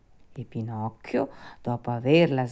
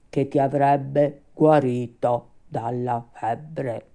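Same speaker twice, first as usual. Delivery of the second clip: measured, ominous creature voice, louder than the narration; grim rabbit undertaker; 6 dB louder than usual. Acted. Rabbit tells the puppet death is near, ominous. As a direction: pronounce a death sentence, slow at start and end.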